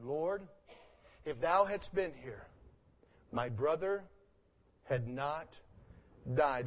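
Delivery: quiet, halting, each phrase set apart; soft slow reread. Shown as a demonstration. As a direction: let the words fall gently, pause between phrases. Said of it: "Look at it again".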